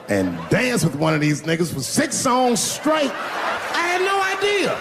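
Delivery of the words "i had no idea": high, slow stunned cry; shocked. Retold or imitated. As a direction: shriek it in disbelief. Shocked realization at the club.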